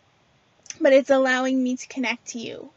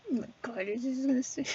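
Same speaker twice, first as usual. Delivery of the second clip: mumbled aside; 8 dB quieter, faster than usual. Real, not acted. Speaker muttering to an unseen presence mid-talk, mumbled and hushed. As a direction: mumble it under your breath. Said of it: "I had to mumble there for a second".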